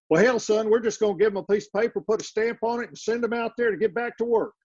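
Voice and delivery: high, fast East Texas drawl from a state official. Retold or imitated. Speaker quoting a Texas official, in an East Texas accent. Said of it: "when you hear this accent that I'm gonna do".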